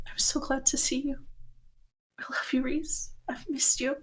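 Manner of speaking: soft, hushed, barely voiced love; wife whispering her love to her husband; tearful whisper. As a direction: whisper it through tears of relief.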